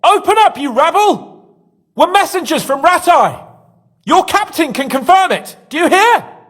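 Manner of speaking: bang on the door and demand attention; shouting at guards. Acted. Prisoner shouts at guards outside.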